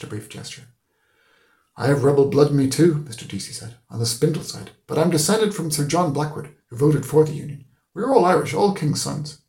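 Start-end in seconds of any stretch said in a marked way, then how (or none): 1.8-3.0 higher, loud, emphatic character voice; Mister Deasy. A narrator's reading. Deasy tells Stephen of his ancestry, loud and emphatic. 3.9-9.4 raised, emphatic character voice, climbing higher; Mister Deasy. A narrator's reading. Deasy boasts of descent to Stephen, high and emphatic.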